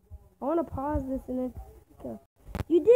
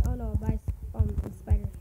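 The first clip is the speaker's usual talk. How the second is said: usual speaking voice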